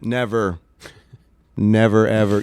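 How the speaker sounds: deadpan, emphatic denial; mock denial. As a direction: deny it firmly with tongue in cheek. Joking denial, then admits all the time.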